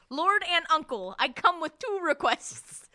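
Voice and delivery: a hint of pompous ceremony; lightly hammed-up hero addressing a god; formal address. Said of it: "refers to Hades ... as".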